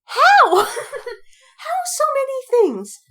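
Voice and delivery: high, loud, laughing disbelief; incredulous disbelief. Retold or imitated. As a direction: shriek at the plot hole, half laughing. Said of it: "it's like".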